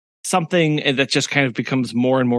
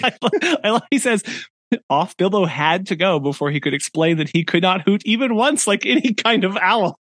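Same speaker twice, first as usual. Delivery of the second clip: giggling through the words; reading aloud through laughter; laughing while talking; 8 semitones higher than usual. Real, not acted.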